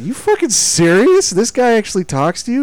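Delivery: high, loud disbelief; incredulous. Retold or imitated. Retelling his own disbelief.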